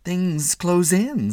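high, drawn-out, drifting reply; vague. A narrator's reading. Startled husband answering his wife, vaguely. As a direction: answer vaguely, stretching the words. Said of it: "said Walter Mitty vaguely".

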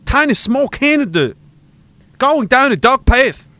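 mock Beijing accent. Beijing party man lamenting Canada's decline, mock Beijing accent. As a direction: act a dismayed onlooker in a mock Chinese accent. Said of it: "a Beijing Communist Party accent".

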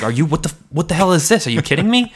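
hammed-up disbelief, voice climbing; imitating angry dad. Dad angry over fake invoice.

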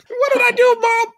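put-on high wail of a kid being punished; whiny kid. Retelling Schwartz crying out over the phone, high wail. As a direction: wail it like a blameless boy. Schwartz again, louder, laughing.

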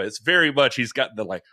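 ordinary delivery

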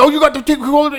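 high, rowdy jobsite bro voice; hyped-up morning dude. Acted. Comedian acting a dude raring to go at six a.m., hyped and high. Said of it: "there's, like, the dude who's".

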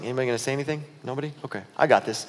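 low, soft muttering to companions; hushed, low aside; furtive aside. Acted. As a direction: check round quietly before speaking up. Thomas checks with others, aside.

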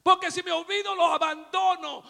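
loud, high, fervent sermon; full-force preaching. Real, not acted. Preacher shouting to his church, full force. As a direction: preach it out to the congregation.

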